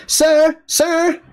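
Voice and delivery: very high-pitched mock calling out; imitated yell. Store greeter calling after a departing customer, imitated high yell. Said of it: "and she was yelling at me".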